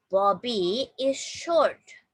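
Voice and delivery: slow, measured model sentence; teacher reading very slowly. Shown as a demonstration. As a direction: draw each word out for learners to copy. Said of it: "Please repeat after me".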